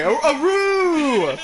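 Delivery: high, slow, shouted tiger howl. Pretend. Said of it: "Go ahead".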